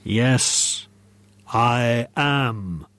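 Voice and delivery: drawn-out, solemn ghostly answer; firm ghost voice. A narrator's reading. Ghost answers the football manager, firm acted voice. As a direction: answer firmly as the apparition. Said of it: "said a firm voice".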